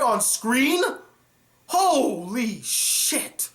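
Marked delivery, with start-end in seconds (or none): none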